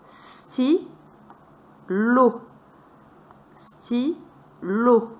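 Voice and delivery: "Stylo" split into two distinct syllables; a clear pause between the syllables of "Stylo"; "Stylo" broken apart, each syllable on its own; separated syllables. Shown as a demonstration. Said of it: "séparer lui-même les syllabes distinctement".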